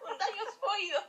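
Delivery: high, laughing mock warning. Real, not acted. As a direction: warn through laughter. Mock advice while laughing. (advertencia en broma entre risas)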